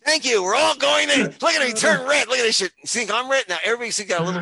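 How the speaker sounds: high, loud mock triumph; comic crowing. Real, not acted. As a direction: shout it to the groaning crowd. Comic crowing after groaner joke.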